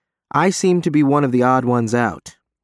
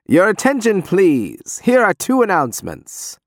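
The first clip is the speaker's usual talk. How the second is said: loudspeaker announcement; 8 semitones higher than usual. Acted. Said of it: "continues the box".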